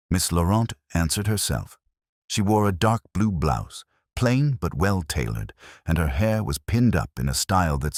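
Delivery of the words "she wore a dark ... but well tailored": ordinary delivery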